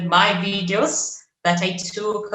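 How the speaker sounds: ordinary talk, nothing marked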